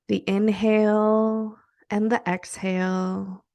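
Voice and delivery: soft, unhurried meditation voice with long pauses; guided meditation. Acted. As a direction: guide them gently through each breath, counting in drawn-out words.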